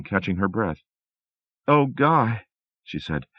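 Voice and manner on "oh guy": upset. A narrator's reading.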